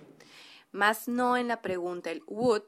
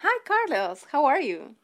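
bright, higher hostess greeting; role-play host; 6 semitones higher, 6 dB louder than usual. Acted. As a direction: welcome a guest warmly, pitched up. Role-play dialogue, wife as A.

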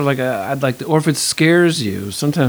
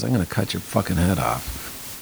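menacing line read low; 6 semitones lower, 5 dB quieter than usual. Shown as a demonstration. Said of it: "You don't have to go".

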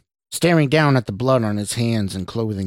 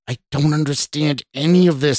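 higher, exasperated cry; raised, frustrated protest; shouting; 5 semitones higher, 4 dB louder than usual. A narrator's reading. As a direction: shout in bewilderment, short of full force. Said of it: "Scott shouted".

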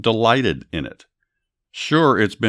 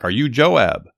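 mild narrated yell, higher voice; storyteller's restrained call, pitched up; yelled back; 5 semitones higher, 4 dB louder, slower than usual. A narrator's reading. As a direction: call back with a rising swell on the name. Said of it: "She yelled back".

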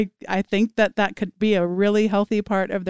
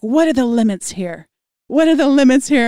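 building from eager to loud, higher excitement; excited students; 5 semitones higher, 7 dB louder than usual. Retold or imitated. Students asking their teacher how far they can go, excited. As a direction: imitate thrilled students, louder on the repeat. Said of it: "such a sense of excitement".

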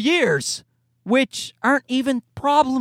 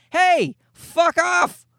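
a slow, higher, dismissive "Hey, fuck off"; mock brush-off; 6 semitones higher, much slower than usual. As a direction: wave the neighbors off, drawn out. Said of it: "Probably went down and said to them".

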